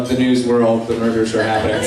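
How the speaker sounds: usual speaking voice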